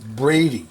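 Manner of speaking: "Brady" said with a long a, like the Brady Bunch; "Brady" rhymes with lady; corrected pronunciation. Real, not acted. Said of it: "No, it says Brady".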